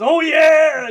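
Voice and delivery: mock Kool-Aid Man battle cry; Kool-Aid Man yell. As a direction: bust through the wall bellowing it like the Kool-Aid Man. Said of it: "kicks that fucking wall in and goes".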